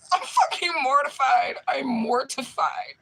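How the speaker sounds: high, loud, half sobbing with laughter; helpless mortified laughter, near tears; laugh-crying. Real, not acted. Laugh-crying over the mortifying memory.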